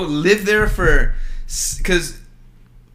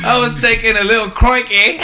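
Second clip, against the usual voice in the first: put-on light playful London accent, a touch higher; mock British accent; 7 semitones higher, 4 dB louder than usual. Joking to a friend, a slightly higher mock London accent. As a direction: try a London accent on a toilet joke. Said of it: "Like, London?".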